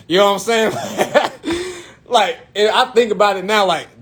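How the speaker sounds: ordinary delivery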